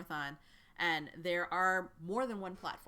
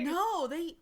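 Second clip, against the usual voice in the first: eager recruiter voice; 11 semitones higher, 8 dB louder than usual. Acted. Recruiter insists.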